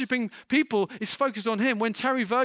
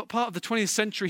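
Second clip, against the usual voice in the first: ordinary talk, nothing marked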